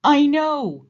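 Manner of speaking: high, loud wailing cry; storybook moose wailing, hammed-up. A narrator's reading. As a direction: wail it out in misery. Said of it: "wailed Moose".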